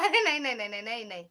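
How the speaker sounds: giggly no no no, loud at the start; real burst of laughter while protesting; laughing denial. Real, not acted. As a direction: laugh right through the nos.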